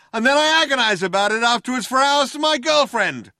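high, loud, sustained hailer shout. A narrator's reading. Cop protesting he is intelligent and caring, shouting through loud hailer. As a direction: keep calling out as if over a loud hailer. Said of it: "said the voice on the loud hailer".

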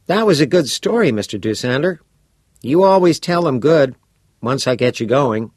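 put-on bright, curious teenage boy; a keen schoolboy pressing for details; eager boy voice. Todd praising.

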